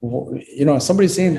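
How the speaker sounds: ordinary talk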